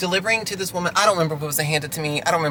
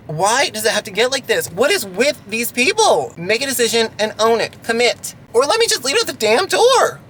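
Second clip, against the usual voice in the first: exasperated rant, pitched up; emotional outburst; 5 semitones higher than usual. Real, not acted. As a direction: let the frustration build to a high peak. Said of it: "I'm a little emotional".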